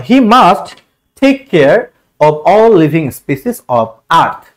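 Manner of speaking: very slow, drawn out word by word; slow dictation reading. Shown as a demonstration. Teacher reading the exam sentence to students, very slow. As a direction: read the sentence out slowly for the class.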